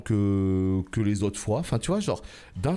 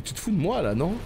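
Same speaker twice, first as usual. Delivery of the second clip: indignant; 7 semitones higher than usual. Real, not acted. Indignant at the boss.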